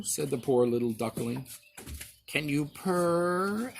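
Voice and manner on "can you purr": slow, purring cat voice; cat purring question. A narrator's reading. Cat quizzing the duckling, slow and purring. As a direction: stretch out purr like a lazy cat. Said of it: "asked the cat".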